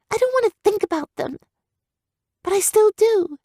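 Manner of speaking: put-on high, troubled girl's voice; young girl haunted by guilt; upset young girl. Girl character voice.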